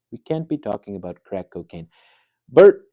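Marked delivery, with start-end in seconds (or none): 2.5-2.8 high, loud name-call; sharp admonishing call. Acted. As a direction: call him out sternly by name. Host sternly admonishes guest on air.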